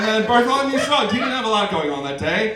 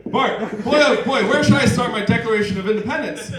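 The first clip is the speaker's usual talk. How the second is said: ordinary talk, nothing marked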